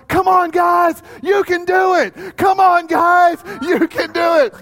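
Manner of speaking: very high, loud urging; rallying shout. Retold or imitated. Junior high boy on his seat to the crowd, rallying shout. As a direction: whip up the crowd at full pitch.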